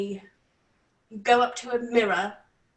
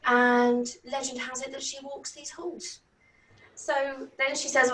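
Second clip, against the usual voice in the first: usual speaking voice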